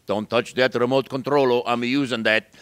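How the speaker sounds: guard the remote like a grumpy dad; Papa. Retold or imitated. Papa in the den guards the TV remote, loud and gruff. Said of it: "Papa was in the den".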